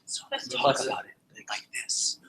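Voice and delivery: whispered like a sales secret; secretive whisper. Shown as a demonstration. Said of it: "It's a secret".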